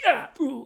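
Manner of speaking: loud, high gasp of relief. Retold or imitated. Kid wetting himself with a loud relieved gasp. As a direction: let out a sudden relieved ooh. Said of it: "I'm just like".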